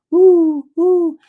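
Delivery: high, loud hoot; owl hoot. Retold or imitated. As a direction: call out like an owl. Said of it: "I hear this".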